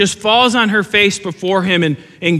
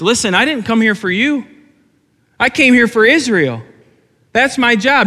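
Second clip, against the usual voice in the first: blunt Jesus; 6 semitones higher than usual. Retold or imitated. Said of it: "And Jesus' response is".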